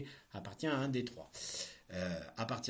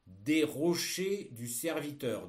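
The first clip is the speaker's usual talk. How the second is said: dictating; 3 semitones higher, 4 dB louder, slower than usual. Shown as a demonstration. Teacher dictating a text.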